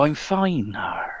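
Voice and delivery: a hint of the pirate's growl, trailing soft; pirate reply. Old pirate answering how he is, lightly growled. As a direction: tack a quiet Arr on the end. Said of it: "And he go".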